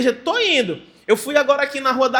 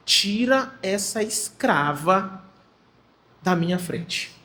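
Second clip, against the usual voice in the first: slow, low, deliberate order; desprezo pesado; 4 semitones lower, 4 dB quieter, much slower than usual. Acted. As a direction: dismiss her coldly, drawing out the words. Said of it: "tentem ler a pessoa falando desse jeito". (ordem lenta e baixa, com desprezo)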